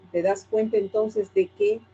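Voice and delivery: ordinary talk, nothing marked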